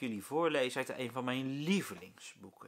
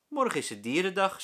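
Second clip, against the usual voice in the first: raised, lively schoolteacher announcing; teacher voice; 7 semitones higher, 7 dB louder than usual. A narrator's reading. Meester Jaap tells his class about animal day, lively. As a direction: tell the class the news brightly.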